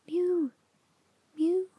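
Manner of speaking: high voiced cat mew breaking the whisper. Hungry cat Simpkin interrupting the mice, high mewing. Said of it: "interrupted Simpkin".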